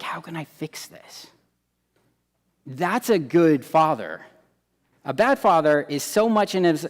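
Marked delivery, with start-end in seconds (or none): 0.0-1.3 hushed, unvoiced musing; whispered thought. God quietly wondering how to fix it, whispered. God voiced thinking privately.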